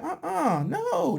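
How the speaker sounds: very high-pitched imitated refusal; mock scared refusal. Acts out woman refusing in bathroom.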